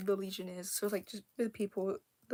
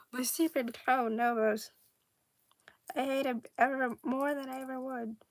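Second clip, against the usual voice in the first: tantrum rant; 3 semitones higher, 7 dB louder than usual. Acted. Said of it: "throwing a temper tantrum".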